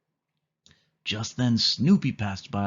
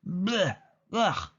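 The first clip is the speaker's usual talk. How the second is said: disgusted blech; 8 semitones higher than usual. A narrator's reading. Disgust after dog kiss.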